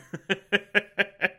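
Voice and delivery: real amused laugh; high, rippling chuckle. Real, not acted. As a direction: laugh at how weird it is. Laughs at weird character.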